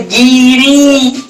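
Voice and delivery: गिरी said slowly, stretching the final ई; sounding out. Shown as a demonstration. Teacher sounding out गिरी so students hear its ending. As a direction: draw out गिरी so the last ई is heard. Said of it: "गिरी का उच्चारण कीजिए".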